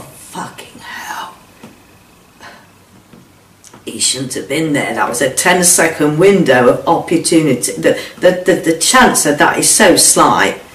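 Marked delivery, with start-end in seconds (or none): 0.0-4.9 slow breathless whisper, much softer; stunned whispered curse. Retold or imitated. Hostage whispering as he spots the gardener, stunned. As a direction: breathe it out in disbelief. Seeing Bob, whispered thought.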